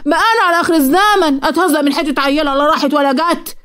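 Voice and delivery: high, indignant complaint; aggrieved mother. A narrator's reading. Mother complaining to Ahmed about Safwa, high and hurt. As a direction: play the wronged mother.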